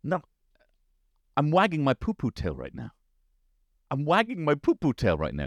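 lightly voiced little kid, proud; little son. Son explaining his poo-poo tail to dad, lightly childlike. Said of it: "But he goes".

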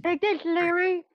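hammy hero battle cry. Pretend. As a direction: yell it like a cartoon superhero. Acting out Wrench Boy's battle cry.

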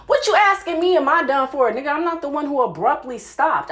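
indignant outburst. Real, not acted. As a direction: rail at him in disbelief. Outraged rant at him.

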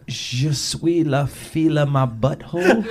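put-on a light jokey French accent; mock French accent. Brother on tour mocking the driver, slow fake French. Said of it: "looks out and goes".

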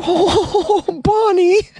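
squealing with delight. Real, not acted. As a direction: gush over her name. Delighted at robber's apology note.

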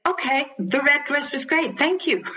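placating, resigned agreement; giving in. Retold or imitated. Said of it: "I would just give in and say".

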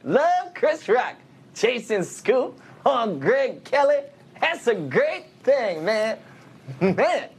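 high, slow, drawn-out comic impression; Chris Rock. Comedian imitating his comic idol for the host, high drawn-out impression. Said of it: "one of your comic idols is Chris Rock".

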